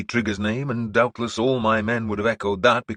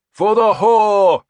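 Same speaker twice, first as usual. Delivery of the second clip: drawn-out shout, much higher and louder, x0.5 slow; slow battle shout; 13 semitones higher, 13 dB louder, much slower than usual. A narrator's reading. Leader spurring laughing men, slow loud shout. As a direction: bellow it with relish. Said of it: "I shouted".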